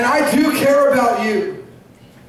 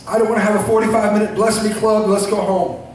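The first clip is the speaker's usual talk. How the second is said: usual speaking voice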